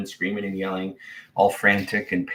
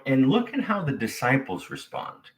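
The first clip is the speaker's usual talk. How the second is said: ordinary talk, nothing marked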